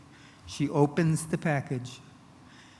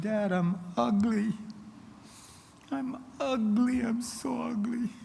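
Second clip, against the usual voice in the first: put-on high, slow, drawn-out cry of a boy; anguished mock shriek; 9 semitones higher than usual. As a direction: imitate a distraught little boy, pitched way up. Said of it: "he shrieked out and he said".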